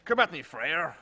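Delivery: loud, hammed-up French accent; terrible French accent. Host taunting viewers: loud hammed-up French accent. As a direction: throw down the challenge in a fake French accent. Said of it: "my terrible French accent".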